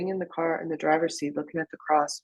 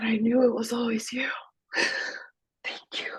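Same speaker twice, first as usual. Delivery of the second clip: breathless whisper, pitch lifted; awed whisper; 6 semitones higher than usual. Retold or imitated. Thanking God in awe for the crucifix, whispered.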